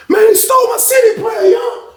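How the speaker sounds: high-pitched yelled complaint; outraged outcry. Retold or imitated. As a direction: cry out to the whole tier. Retells victim crying out theft.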